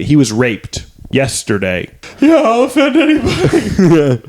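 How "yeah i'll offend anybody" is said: high giggly voice breaking into laughter; mocking the edgy comic through laughter; laughing through it. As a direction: laugh while pushing the line out.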